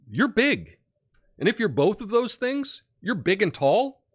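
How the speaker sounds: ordinary talk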